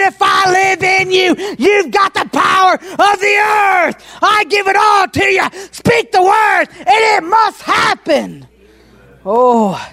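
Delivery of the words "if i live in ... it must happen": high, ringing preacher's shout, slowed on the ends; booming pulpit delivery; full-force preaching. Acted. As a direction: proclaim God's promise to the whole church. Preacher voicing God's promise.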